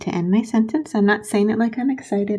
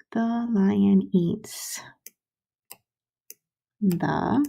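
slow; much slower than usual. Shown as a demonstration. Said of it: "stretched while writing".

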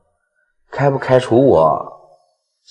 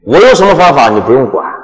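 gloating laugh; 5 semitones higher, 12 dB louder, faster than usual. Acted. Villain gloats at the heroine.

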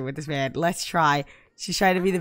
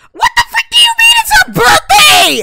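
very high, loud furious scream; shrieking at the game, outraged; 17 dB louder than usual. Real, not acted. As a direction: scream it in disbelief. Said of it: "I'm about to start screaming".

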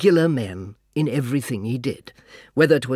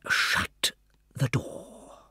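hushed aside; 9 dB quieter, much slower than usual. A narrator's reading.

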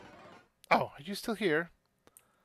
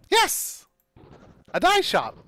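excited squeal; 12 semitones higher, 9 dB louder than usual. Acted. Excited shopkeeper.

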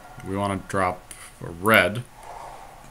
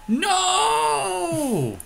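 sudden high cry of dismay; a shocked, squeaky no at the bad turn; dismayed yelp. Real, not acted. Player caught off guard by the opponent's move, high startled yelp. Sudden game setback while yielding.

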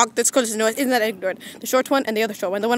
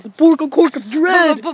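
slow, louder movie-trailer title drop; mock-epic slow title, louder; dramatic title; 3 semitones higher, 5 dB louder than usual. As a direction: announce it like an ominous trailer, slowly. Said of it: "We should make a remix out of that".

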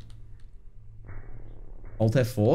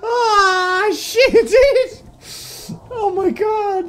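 laughing off fear; 13 semitones higher, 7 dB louder, slower than usual. Real, not acted. Still shaken after the scare.

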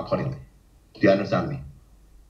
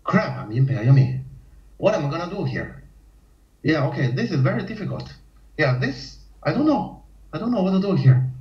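high, fretful mock panic climbing higher; panicking player; 6 semitones higher than usual. Player in byoyomi losing his nerve, high fretful mock panic. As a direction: whine helplessly, pitch shooting up. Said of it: "can you imagine in Bayomi you have this kind of".

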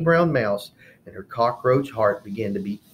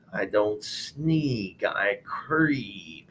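creepy lizard; 4 dB quieter, much slower than usual. Acted. Creepy lizard introduces himself.

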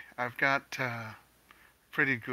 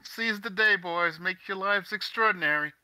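higher, louder, slow declaiming toast; grand toast; 9 semitones higher, 7 dB louder than usual. Acted. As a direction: proclaim it like a rousing speech to the boys. Player toasts in character.

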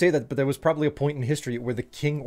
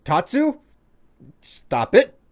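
playful scolding; 11 semitones higher, 5 dB louder, slower than usual. Real, not acted.

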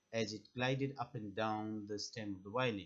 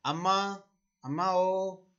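booming wail; 7 semitones higher, 9 dB louder than usual. A narrator's reading. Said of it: "He raised his voice".

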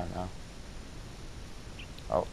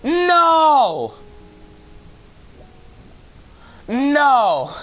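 high, loud desperate cries of no; real panicked shouts; panicked screaming; 7 dB louder, much slower than usual. Real, not acted. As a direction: scream as the pet flies off. Pet flies away, screaming.

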